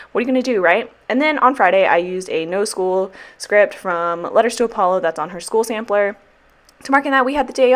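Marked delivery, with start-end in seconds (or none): none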